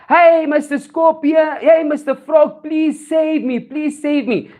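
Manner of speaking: high, drawn-out plea; acted scream for help. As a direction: call out pleadingly across a river, pitched high. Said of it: "hears Mister Scorpion scream".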